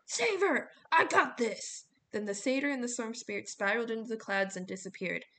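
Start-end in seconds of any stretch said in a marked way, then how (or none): 0.1-1.8 high, loud shouted order; Coach Hedge shouting. A narrator's reading. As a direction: shout down urgently from above. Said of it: "Coach Hedge shouted down once more".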